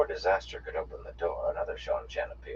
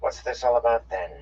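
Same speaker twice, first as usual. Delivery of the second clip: put-on sharp twangy Seanchan accent, pitched up and louder; 7 semitones higher, 8 dB louder than usual. A narrator's reading. Officer questioning her soldiers, in a sharp twangy accent. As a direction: demand an answer in a clipped twang. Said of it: "Her slurred accent had a twang".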